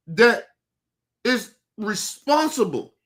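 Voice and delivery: usual speaking voice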